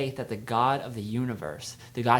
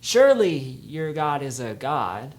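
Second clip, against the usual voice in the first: sarcasm; 3 semitones higher, 7 dB louder, slower than usual. Retold or imitated. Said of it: "speak with sarcasm".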